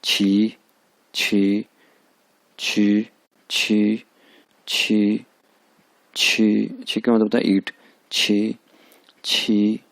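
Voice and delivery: छी held level in the first tone, aspirated chh; first tone छी drill. Shown as a demonstration. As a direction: repeat छी flat and steady. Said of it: "first one है छी".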